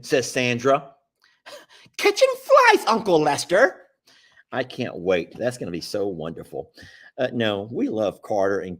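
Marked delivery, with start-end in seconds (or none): 2.0-3.9 high, loud squawked question; mock astonishment. Repeating a viewer's comment back in disbelief, high and loud. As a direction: exclaim it as if incredulous.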